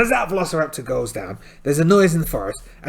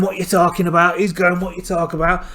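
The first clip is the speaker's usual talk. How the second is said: higher, louder scrappy quarrel; loud mock bar argument; pub brawl shouting; 5 semitones higher, 6 dB louder than usual. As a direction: argue like drunks in a pub fight.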